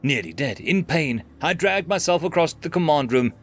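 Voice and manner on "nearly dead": ordinary delivery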